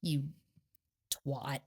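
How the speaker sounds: quiet scornful jab at Christopher; retold muttered insult. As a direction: drop it low and dismissive. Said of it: "And I was like".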